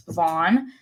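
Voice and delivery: usual speaking voice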